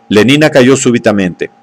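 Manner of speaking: plain reading voice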